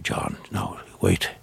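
low, half-whispered confidential aside; hushed aside. Retold or imitated. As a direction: keep it down, partly whispered. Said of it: "Jerry, who would be saying".

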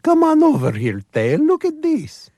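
very high, slow Eastern European coaxing; dog's Eastern European voice. Acted. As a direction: lure the tail over in a sweet, high Eastern European accent. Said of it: "and it says".

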